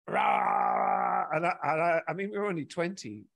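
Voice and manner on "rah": mock angry roar of a girlfriend; imitating a furious French girlfriend roaring. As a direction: bark out a wordless angry yell. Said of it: "it used to be just".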